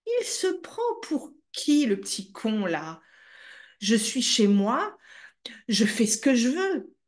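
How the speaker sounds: mother scolding her son, high and indignant; mother's retort. Retold or imitated. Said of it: "elle m'a regardée comme si j'étais fou et elle m'a dit".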